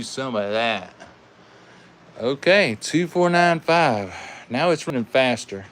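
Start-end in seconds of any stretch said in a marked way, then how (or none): none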